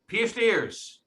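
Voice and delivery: loud, high Rhode Island "pierced ears"; Rhode Island accent. Shown as a demonstration. Repeating for a person way in the back, loud Rhode Island accent. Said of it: "A person way in the back".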